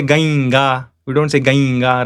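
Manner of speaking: Gainga with an i-glide before the nasal; wrong palatal nasal. Shown as a demonstration. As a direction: say Gainga, first vowel sliding to ai.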